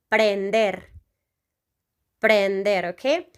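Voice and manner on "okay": normal talking voice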